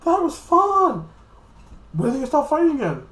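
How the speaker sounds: put-on very high, loud childlike squeal; high-pitched mock little-ruler voice; Zenos. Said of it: "the two Xenos are like this".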